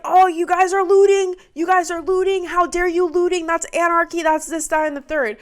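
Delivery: hammed-up scandalised accusing; mock accusation. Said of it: "everyone was like".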